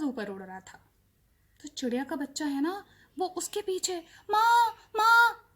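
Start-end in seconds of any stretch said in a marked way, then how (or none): 4.3-5.5 slow, high, loud calls; baby bird running after the plane, calling loudly. A narrator's reading. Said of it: "बोलता हुआ दौड़ा".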